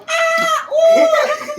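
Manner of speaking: shrill burst of laughter; real laugh breaking up the story; high shrieking laugh. Real, not acted. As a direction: laugh out loud, high and long. Laughing fit mid-story.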